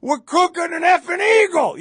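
high, loud rowdy bellow holding up the bird; imitated fan's shout. Fan shouting at passing fans over a grilled bird, loud rowdy yell. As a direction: roar it like a wild tailgater. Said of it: "he goes".

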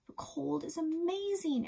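a bright lift of wonder; enthusing. A narrator's reading. Narrator girl enthusing.

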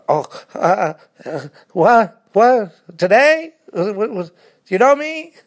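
high, stammering, panicky voice; mock flustered reply. Acting out his flustered answer to the cashier. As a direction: stumble over the words in mock alarm.

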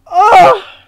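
high, loud yelp; sudden high scream of fright; startled scream. Real, not acted. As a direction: shriek at a jump scare. Startled by the video.

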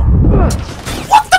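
high, loud startled yell; alarm shout. Real, not acted. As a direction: cut off mid-yell in shock. Shock at a play.

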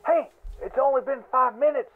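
normal talking voice

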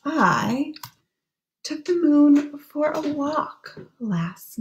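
put-on hushed, lilting read-aloud for children; gentle storybook voice; story reading. Reads the rhyming book aloud to children.

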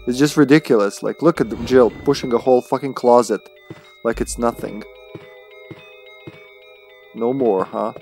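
normal talking voice

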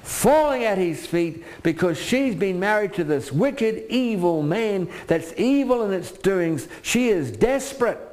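high, slow, fervent preaching; preaching at full force. Acted. Preacher to congregation, high fervent preaching. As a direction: preach it like a plea. Preacher retelling Abigail's plea.

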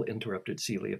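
plain reading voice

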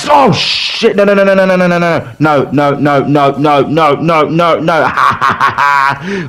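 real panic as the match slips away; panicked pleading. Real, not acted. Team about to lose the match.

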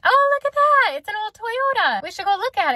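high, excited burst as if spotting it. Retold or imitated. Wife excitedly points out a truck to her husband, high and eager.

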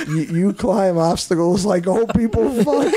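slow, high mock drill-sergeant insult; Gunny. Quoting the drill sergeant insulting recruits, mock scorn. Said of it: "What about Gunny from, uh, Full Metal Jacket?".

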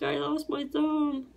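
high, slow whimper; imitating a moaning, whining cry; whiny crying. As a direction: whine it out like you're in shock. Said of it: "You know, crying".

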